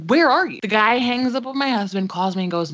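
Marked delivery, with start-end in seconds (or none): 0.1-0.6 mildly exasperated, higher-pitched retelling; exasperated demand. Retold or imitated. Husband chasing the no-show serviceman, mildly exasperated. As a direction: demand an explanation, voice lifting.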